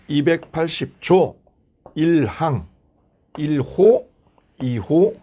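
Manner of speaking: slow legislative citation with pauses; formal statute reading. Shown as a demonstration. Teacher shows how parliament reads laws, measured citation. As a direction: read each number as its own measured unit. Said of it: "이렇게 읽어".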